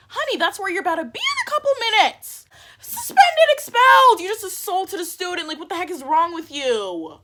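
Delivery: high, loud scolding, peaking in a shout; hammed-up outraged telling-off; furious tirade. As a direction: build to a shrieked verdict, then ease down. Acted rant at the friend.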